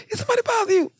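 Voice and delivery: squeaky high-pitched mock question. Retelling a fellow inmate's mocking question, high voice. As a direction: mimic him in a high squeak.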